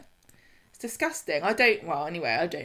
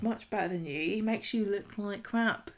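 quiet mocking; 8 dB quieter than usual. Voicing everyone dismissing James.

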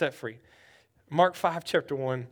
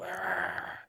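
low, rough seizure noise; imitated growl; 12 semitones lower, 10 dB quieter than usual. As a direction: make a low growl like someone having a fit. Imitating the boy foaming at mouth.

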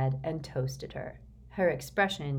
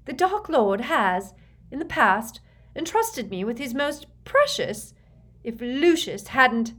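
raised, emphatic protest; indignant Bellatrix; 8 semitones higher, 6 dB louder than usual. A narrator's reading. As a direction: insist with wounded pride. Bellatrix defends herself in quarrel.

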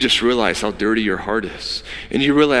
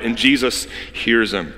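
usual speaking voice

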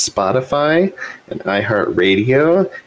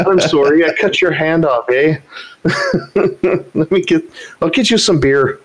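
a higher, hammed-up Canadian accent with a giggle; mock apologetic Canadian; 7 semitones higher than usual. Mocking Canadian horror as a polite killer, in a Canadian accent. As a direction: offer beer after cutting off a hand, sweetly Canadian. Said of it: "We've made fun of Canadians".

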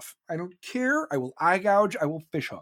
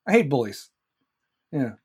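ordinary talk

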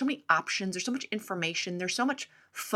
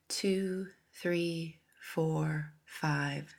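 careful unhurried voice; 3 semitones lower, 4 dB quieter than usual. Acted. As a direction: count the breaths calmly and softly. Leads breathing meditation.

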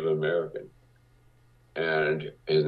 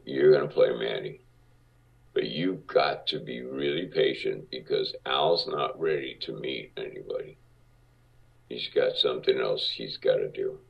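much lower, gruff producer voice; Marty Bregman's deep voice; 5 semitones lower than usual. Retold or imitated. Producer sizing up a young actor, lower deep voice. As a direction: drop low and heavy like a big-shot producer. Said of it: "he had a deep voice like this".

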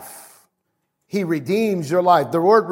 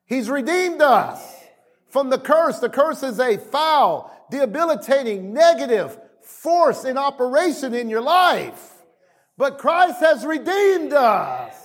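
high-pitched, driving sermon delivery; impassioned preaching; 4 semitones higher than usual. Real, not acted. Preacher declaring redemption from the curse to his congregation, impassioned preaching. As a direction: preach it with rising fervor.

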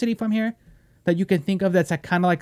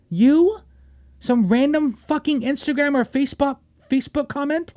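high, incredulous questioning; pitched-up scoffing incredulity; scornful disbelief; 7 semitones higher than usual. Acted. Scoffs at who demands checking in.

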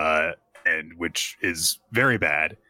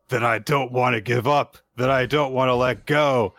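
dramatic building cry; 6 semitones higher than usual. Acted. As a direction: climb into a desperate dramatic wail. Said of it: "It's so dramatic".

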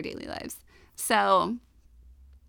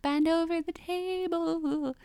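high, slow, wailing ghost voice; mock spooky ghost; 7 semitones higher, 4 dB quieter than usual. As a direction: draw it out like a pretend ghost. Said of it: "still making spooky sounds".

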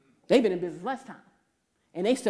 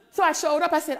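ordinary talk

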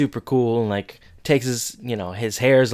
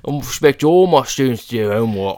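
Cockney accent slipping into a Chicago teacher impression; Cockney-flavoured attempt at a stern teacher; teacher's line said in a Cockney accent, a little louder; accidental Cockney; 5 dB louder than usual. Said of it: "That's Cockney".

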